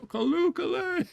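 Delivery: very high falsetto swoon; Principal Skinner. Retold or imitated. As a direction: squeak it out in a giddy falsetto. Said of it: "Principal Skinner ... he goes".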